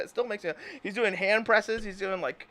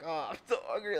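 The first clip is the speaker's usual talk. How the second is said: mock hungry murmur; 4 semitones lower, 7 dB quieter than usual. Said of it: "and you're like".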